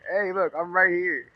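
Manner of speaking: high, loud mock call-out; screaming for attention. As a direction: playfully holler to be noticed. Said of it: "this hat and that mask is screaming".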